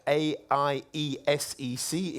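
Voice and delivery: ordinary delivery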